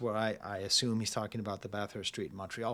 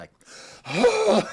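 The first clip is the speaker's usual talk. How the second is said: a sharp gasp, then fast, retreating words; shocked by the cold; 10 dB louder than usual. Retold or imitated. Describing the cold of a Canadian winter, mock shocked gasp. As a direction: mimic stepping into the freezing air and fleeing.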